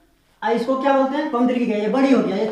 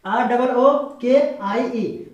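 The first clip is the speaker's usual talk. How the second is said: slow spelling out; much slower than usual. Shown as a demonstration. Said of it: "spelling out the word letter by letter".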